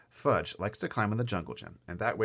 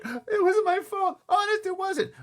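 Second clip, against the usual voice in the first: very high, loud wail; crying out defensively; 8 dB louder, slower than usual. A narrator's reading. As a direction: wail your innocence in a girl's voice. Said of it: "Sheila cried".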